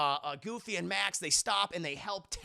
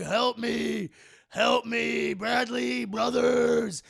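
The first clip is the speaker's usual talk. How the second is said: high, loud plea, stretched out; acted cry for rescue; calling for help; 4 semitones higher, 8 dB louder, much slower than usual. Trapped Tank calling to his frat brothers, desperate shout.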